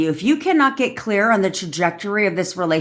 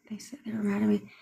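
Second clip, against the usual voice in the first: quick soft whisper of the comment; 3 semitones higher, 10 dB quieter, faster than usual. Real, not acted. Rereading a viewer's comment to herself in a whisper. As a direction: breathe the words out, no voice, a little fast.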